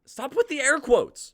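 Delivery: very high, very loud yell; exasperated yell. Acted. Amy Lynn yelling at Natalie over her air quotes, very high and loud. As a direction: blow up at her, shrieking.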